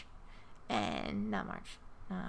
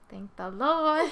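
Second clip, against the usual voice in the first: loud, drawn-out praise, pitched up; exaggerated relief; 5 semitones higher, 8 dB louder, slower than usual. Worker relieved her relative's insurance held, loud drawn-out thanks. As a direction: belt it out slowly in mock-thankful relief.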